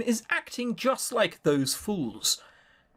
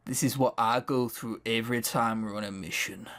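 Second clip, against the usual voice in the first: ordinary talk, nothing marked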